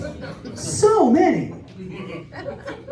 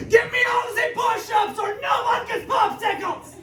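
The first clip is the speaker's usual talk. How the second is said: high-pitched, aggressive mock German accent; angry militant German. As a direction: bark it as a hyper-militant cartoon German. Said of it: "like, angry, aggressive, like, hyper-militant".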